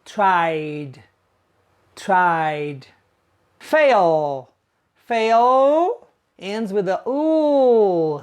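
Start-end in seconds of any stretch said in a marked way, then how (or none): 0.0-2.9 tried, low with voiced d; d ending. Shown as a demonstration. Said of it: "So the past is pronounced".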